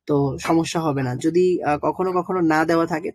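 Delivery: normal talking voice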